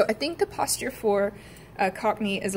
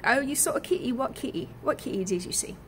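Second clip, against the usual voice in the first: Cockney example; 5 semitones higher, faster than usual. Shown as a demonstration. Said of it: "So if you've got like".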